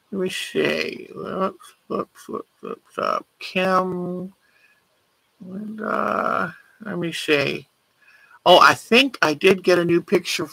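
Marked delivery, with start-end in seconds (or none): 0.1-7.7 very soft murmur to herself while searching; barely voiced, as if thinking aloud; absent self-talk. Real, not acted. Searching through her pictures to herself.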